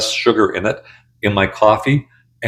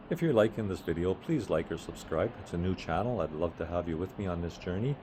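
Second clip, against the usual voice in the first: quiet aside; 2 semitones lower, 17 dB quieter than usual. Real, not acted. Channel plug aside.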